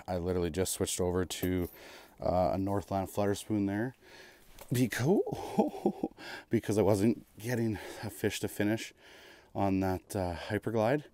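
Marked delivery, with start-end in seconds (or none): 5.2-6.4 laugh. Real, not acted. Laughing mid-explanation.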